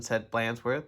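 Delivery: ordinary delivery, nothing marked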